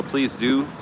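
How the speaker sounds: ordinary talk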